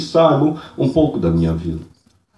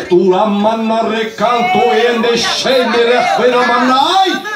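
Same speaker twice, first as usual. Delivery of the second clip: raised, fervent burst of tongues; speaking in tongues; 7 semitones higher than usual. Real, not acted. Preacher breaking into tongues mid-message, fervent.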